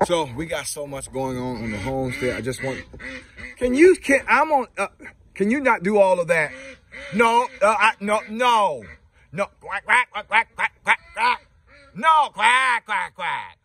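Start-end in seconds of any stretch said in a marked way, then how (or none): 3.6-6.5 high, loud telling-off to the ducks; high-pitched scold at noisy birds; exasperated scolding. Real, not acted. Scolding noisy ducks mid-video. 7.1-8.8 high, slowed protest; high scolding no. Real, not acted. Scolding noisy ducks. 9.3-13.6 high, loud imitation of quacking ducks; parroting the ducks high and loud; mock duck quacking. Mocking duck quacks at birds.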